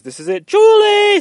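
high, loud, drawn-out scream of joy. Acted. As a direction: yell it across the room, thrilled. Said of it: "Someone screams".

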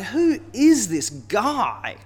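put-on drunken jeering. Drunken leaders mocking Isaiah, jeering. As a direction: sneer it at the prophet, dragging the words. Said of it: "jeering and drunkenly mocking him".

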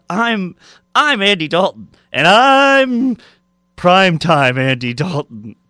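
rising, drawn-out goofy cartoon voice; badly attempted cartoon impression; hammed-up, slow cartoon character announcing himself; Patrick Star. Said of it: "me trying to do Patrick".

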